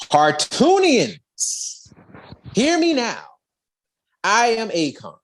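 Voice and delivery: slow, booming warlord address; grand proclamation. Retold or imitated. Akron addressing the fleeing Hartoonians, slow and commanding. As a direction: proclaim it to the crowd like a vengeful lord.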